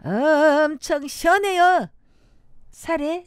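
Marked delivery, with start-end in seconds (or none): 0.0-1.9 loud, slow Chungcheong dialect; 충청도 사투리. Shown as a demonstration. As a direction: stretch it out loud, Chungcheong style. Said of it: "충청도". (크고 느린 충청도 사투리)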